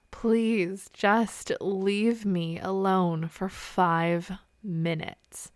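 very slow, each word weighted; exasperated plea. Acted. Overwhelmed mom begging her kids for space, slow exasperated plea.

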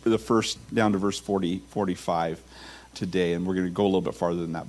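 ordinary talk

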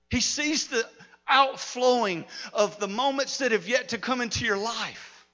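ordinary talk, nothing marked

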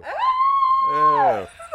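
loud, high drawn-out squeal; appalled shriek. Real, not acted. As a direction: shriek in horrified amusement. Appalled reaction to the shocking quote.

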